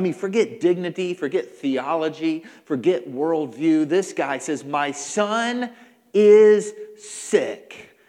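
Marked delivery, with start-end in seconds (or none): 4.7-8.0 raised, emphatic declaration; forceful plea; desperate father. Acted. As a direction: punch each word with rising force. Desperate father's words acted out.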